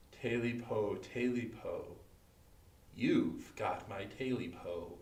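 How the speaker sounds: soft, slow and final; a quiet verdict, drawn out and cold; chilling statement. A narrator's reading. Creature in the bed tells the guest, slow and chillingly soft. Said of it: "followed by a statement".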